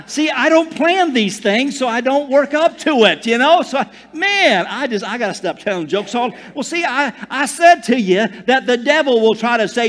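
loud, high, driving sermon voice; full-force preaching. Acted. Pastor preaching loudly to his congregation. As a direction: preach it out to the whole room.

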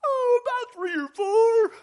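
high, loud, slow cartoon wail; Pete Puma voice. Preacher acting Pete Puma's reply to Bugs, high slow squeal. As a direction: squeal it out like a dopey cartoon cat. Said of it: "speaking the sugar".

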